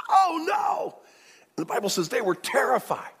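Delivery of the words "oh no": sudden high yelp of alarm; 1 shocked cry. Retold or imitated. Brother finding silver in his sack, high startled cry. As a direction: gasp it out, panicked. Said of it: "and he goes".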